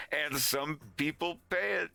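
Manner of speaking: ordinary talk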